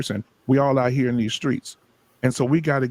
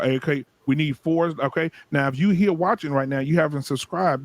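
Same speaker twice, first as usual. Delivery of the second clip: usual speaking voice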